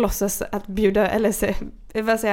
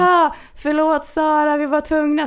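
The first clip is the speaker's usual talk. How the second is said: high, drawn-out mock apology; stretched apology, imitating the pranksters; imitated pranksters; 6 semitones higher, slower than usual. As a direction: call it out high like gleeful pranksters. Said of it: "högtalare och bara".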